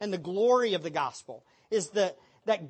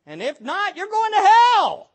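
louder, higher warning shout; bold proclamation; 8 semitones higher, 8 dB louder than usual. Acted. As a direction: call it out boldly to the crowd. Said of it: "finally say".

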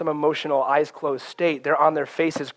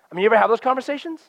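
ordinary talk

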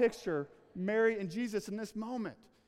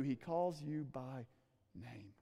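hushed emphasis; 6 semitones lower, 8 dB quieter, slower than usual. Real, not acted. Slowing, fading preacher emphasis.

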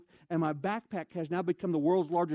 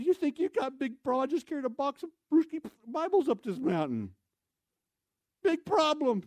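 exasperated retort; 9 semitones higher, 3 dB louder than usual. Retold or imitated. Speaker fires back at the manager.

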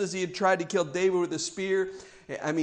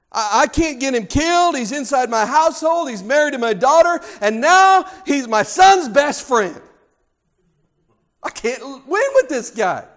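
exasperated Saul; 7 semitones higher, 7 dB louder than usual. Acted. Preacher voices Saul's frustration.

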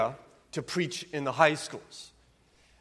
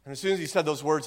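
ordinary talk, nothing marked

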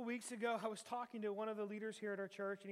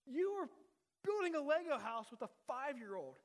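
louder, higher, teasing rebuke from God; God scolding; 5 semitones higher, 5 dB louder than usual. Retold or imitated. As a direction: scold yourself like God telling you off, half-joking. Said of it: "He's like".

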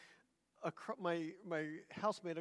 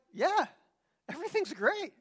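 high-pitched, over-bright reassurance; feigned cheerfulness; 8 semitones higher, 3 dB louder than usual. Retold or imitated. Girlfriend after a screaming fight, high and falsely fine. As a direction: insist all is well, pitched right up.

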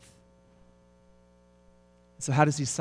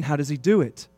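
ordinary talk, nothing marked

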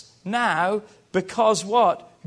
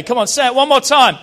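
louder, laughing push; laughing, revved up; 4 semitones higher, 8 dB louder, faster than usual. Shown as a demonstration. Pastor urging the crowd to repeat, loud and laughing. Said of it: "say it with an attitude today".

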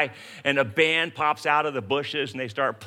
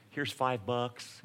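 1 mock stingy offer; 4 semitones lower, 7 dB quieter, slower than usual. Mock offering cheap thanks.

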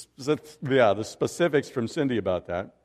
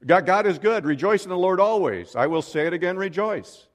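higher, louder burst of praise; joyful exclamation; 6 semitones higher, 4 dB louder than usual. Real, not acted. Preacher rejoicing with congregation over new opportunities, exultant and raised. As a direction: proclaim it joyfully to the whole room.

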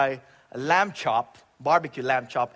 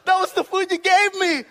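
higher, louder, anguished crying plea; bawling at God; 9 dB louder than usual. Retold or imitated. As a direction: wail at God in half-laughing anguish. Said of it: "just started bawling and crying".

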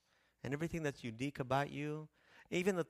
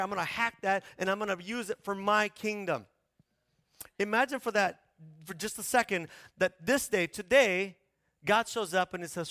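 raised, louder preacher voice driving the point home; forceful preaching; 8 semitones higher, 9 dB louder than usual. Acted. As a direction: preach insistently, high and loud, as God taking charge. Preaches as God taking over.

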